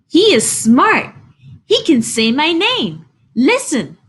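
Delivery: bursting to prove his pet is clever; eager boy. Acted. Boy shows off pet to parents.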